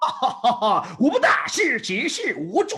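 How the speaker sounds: high, slow, laughing Chinese movie voice; mimicking Chinese movie dialogue. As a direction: ham up an old Chinese film line. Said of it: "I would mimic Chinese movies".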